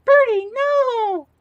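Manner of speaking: very high, slow squeal of a cartoon girl; Ruby impression. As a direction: squeal it like a cartoon girl scolding her pet. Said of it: "No, like".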